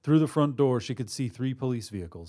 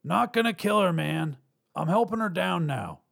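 high, raised call; hollered; 8 semitones higher than usual. A narrator's reading. Robber helping hostage to his partner, hollered. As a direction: call across to reassure your partner. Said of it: "he hollered to his partner".

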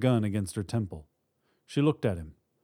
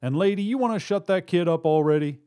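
sarcastic shout; 7 semitones higher, 6 dB louder than usual. A narrator's reading. Robber to partner and mother, impatient shout. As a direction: jeer at partner, then order impatiently. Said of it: "China shouted".

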